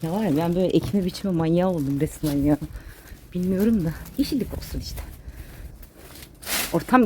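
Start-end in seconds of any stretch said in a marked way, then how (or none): none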